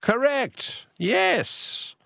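high, bright burst of delight; excited correct. Real, not acted. Quiz host cheering caller Andrew's correct answer, pitched high and excited. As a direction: celebrate the right answer.

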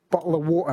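"bottle of water" with the t's dropped; Cockney glottal stops in "bottle of water"; dropped letters. Shown as a demonstration. Co-host shows off the fun accent, Cockney dropped letters. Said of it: "you get to drop letters like water".

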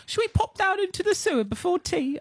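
high, hammed-up mystery British accent; odd put-on accent. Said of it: "I don't know what accent that was".

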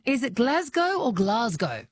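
first Glasgow short "a", second Glasgow long "ah"; contrasted pronunciations. Shown as a demonstration. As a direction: say Glasgow as "Glaz-go", then Glasgow as "Glahz-go". Contrasts two pronunciations for caller.

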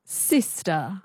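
transatlantic Sister with no final r; transatlantic accent. Shown as a demonstration. Learner echoing the coach, transatlantic accent. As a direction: copy a non-rhotic Sister. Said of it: "the transatlantic accent".

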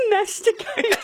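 giggling through the word; reading the text while laughing. Retold or imitated. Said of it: "Another text says, "I hope Luke just said".

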